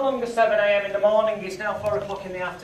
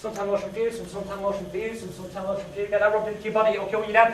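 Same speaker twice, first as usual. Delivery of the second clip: ordinary talk, nothing marked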